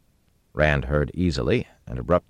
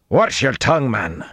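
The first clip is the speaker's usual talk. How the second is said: high, loud, angry warning; indignant raised voice; snapped; 9 semitones higher, 5 dB louder than usual. A narrator's reading. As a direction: snap a sharp rebuke. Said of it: "Gregorin snapped".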